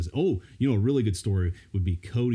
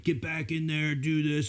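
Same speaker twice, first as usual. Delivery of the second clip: higher-pitched mock yell, held back in volume; retold coach yell; 6 semitones higher, slower than usual. Coach to kid on the field, mock yelling. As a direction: imitate barking orders without full volume. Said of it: "So I'm yelling at him".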